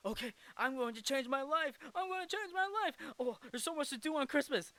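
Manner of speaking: high, eager excited resolve; Ebenezer. Retold or imitated. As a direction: gush it quickly, pitch climbing. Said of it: "George Bailey is excited too".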